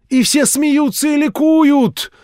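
high, loud, slow preaching voice; fervent street-preacher sermon; zealous old preacher. A narrator's reading. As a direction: warn him of doom like a revival preacher.

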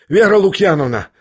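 high, urgent call; hurried summons. A narrator's reading. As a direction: call out to her hastily. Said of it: "торопливо пригласил Ипполит".

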